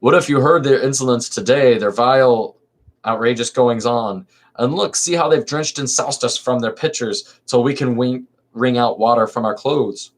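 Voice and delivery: lively theatrical reading of a verse comedy; dramatic play reading. Acted. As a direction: read the chorus lines with stage energy. Reads men's chorus.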